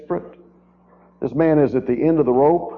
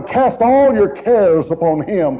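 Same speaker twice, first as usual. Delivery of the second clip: slow, raised scripture reading; scripture quote; 4 semitones higher, slower than usual. Acted. Preacher quoting scripture to comfort listeners, slow. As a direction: lift the verse slowly. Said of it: "Cast it upon him".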